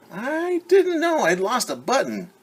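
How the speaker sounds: high, small bear voice, puzzled; a little toy bear wondering aloud; Corduroy to himself. A narrator's reading. Bear talking to himself about his lost button, high and small. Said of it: "he said to himself".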